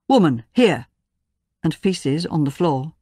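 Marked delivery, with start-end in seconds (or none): 0.1-0.9 loud barked soldier's command; barked order. Retold or imitated. A soldier orders a woman over, loud barked command. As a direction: bark it like a rough soldier ordering her.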